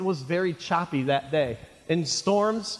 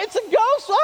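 high-pitched, louder mock panic; terrified; 5 dB louder than usual. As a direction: squeal it like a panicking disciple. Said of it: "I mean, they're terrified".

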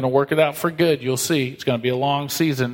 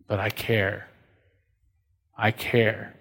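much softer, lower, heartfelt; tender; 4 semitones lower, 5 dB quieter, slower than usual. Retold or imitated. Pastor voicing God's love for a wayward child, soft and tender. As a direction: let it fall quiet with real care. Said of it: "God says".

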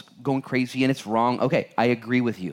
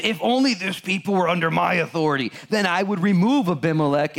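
put-on drunken boast; 6 semitones higher, 3 dB louder than usual. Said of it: "Gaul's big drunken speech".

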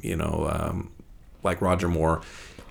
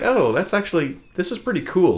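pleasantly surprised; 11 semitones higher than usual. Retold or imitated. Retold own surprised reaction.

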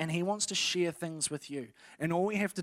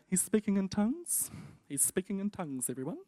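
put-on higher, softer smart-kid voice; know-it-all kid; 5 semitones higher, 3 dB quieter than usual. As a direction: inform the group like the class know-it-all. Smart kid informing the other kids.

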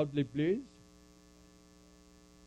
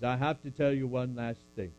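ordinary talk, nothing marked

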